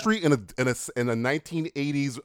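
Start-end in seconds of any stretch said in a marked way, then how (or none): none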